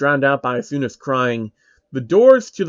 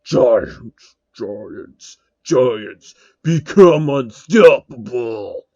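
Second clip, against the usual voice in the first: lower, distant monster chant, slow at the end; deep voice; 3 semitones lower, 3 dB louder, much slower than usual. Acted. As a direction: intone it like a transformed beast. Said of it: "A deep voice".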